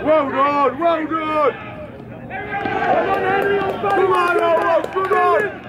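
loud encouraging shout; yelling from the sidelines. Real, not acted. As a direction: cheer them on across the pitch. Parent yelling at match.

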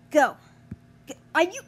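ordinary talk, nothing marked

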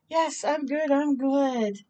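usual speaking voice

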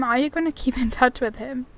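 ordinary delivery

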